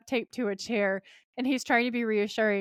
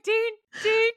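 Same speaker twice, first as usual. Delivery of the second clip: panicked calling; 12 semitones higher, 4 dB louder than usual. Retold or imitated. Sam calling out as Dean dies.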